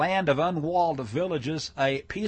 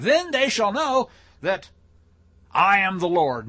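loud, high, hammed-up proclamation; joking scripture recital; 4 semitones higher, 8 dB louder than usual. Shown as a demonstration. Preacher joking about a familiar verse, mock-grand proclamation. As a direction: overdo the solemn verse, slow and booming. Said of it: "one of those verses I sometimes joke about".

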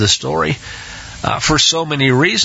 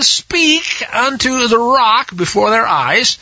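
"speak" lifted high, whole line slow; slow down and lean on "speak"; emphasized speak; 6 semitones higher, slower than usual. Shown as a demonstration. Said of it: "I'm gonna emphasize that".

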